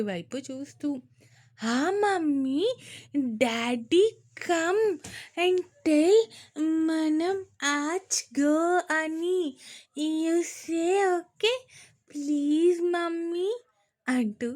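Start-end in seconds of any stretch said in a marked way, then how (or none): 1.6-11.6 put-on high, slow child's voice begging her mother; a small girl tugging her mom's hand, pleading; pleading little girl. A narrator's reading. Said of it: "బ్రతిమాలుతూ ఉంది వన్య". 12.1-13.5 put-on "please" drawn out high and slow in a child's voice; pleading little girl. A narrator's reading. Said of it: "బ్రతిమాలుతూ ఉంది వన్య".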